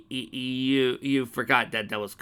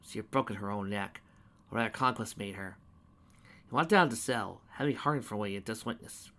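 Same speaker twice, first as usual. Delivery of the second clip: ordinary delivery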